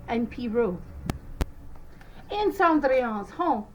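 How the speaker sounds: plain reading voice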